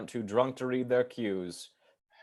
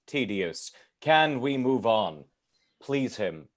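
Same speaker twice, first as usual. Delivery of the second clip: usual speaking voice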